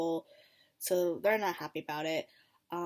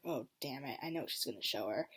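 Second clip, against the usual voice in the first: muttered dread; 7 semitones lower, 7 dB quieter, faster than usual. Retold or imitated. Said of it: "I'm like".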